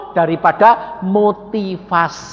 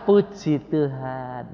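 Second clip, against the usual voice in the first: slow, soft, lower mock-pious voice; mock serene praise; 5 semitones lower, 8 dB quieter, much slower than usual. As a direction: pretend to praise God calmly, drawn out. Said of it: "saudara bilang ... saudara bisa". (pura-pura kalem memuji Tuhan)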